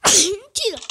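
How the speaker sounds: loud amazed outburst; surprised shout. Real, not acted. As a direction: shout in disbelief. Surprise in game.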